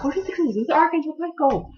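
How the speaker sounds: very high, scandalised imitation; mock shocked protest. Acts woman protesting to Joseph.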